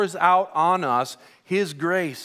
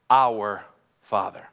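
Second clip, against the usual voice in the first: "our" drawn out slowly and leaned on; stress on our; 8 semitones lower, slower than usual. Shown as a demonstration. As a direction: linger on "our" in "our Father". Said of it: "I want you to notice that little word, our".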